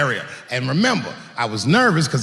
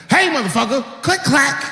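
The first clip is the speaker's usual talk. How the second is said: mock threat; 8 semitones higher than usual. Racking shotgun at intruder.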